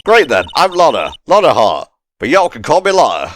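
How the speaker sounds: lightly played Southern US accent; friendly Southern twang, not overdone; southern drawl. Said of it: "This accent is very fun".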